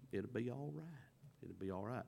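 soft, quiet soothing; a gentle it'll be all right; reassuring wife. Retold or imitated. Wife calming her upset husband, softly reassuring.

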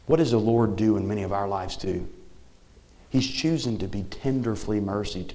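normal talking voice

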